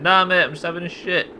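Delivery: loud, high broad Yorkshire punchline; broad Yorkshire bloke; Yorkshire accent. Shown as a demonstration. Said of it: "You gotta put a Yorkshire accent on it".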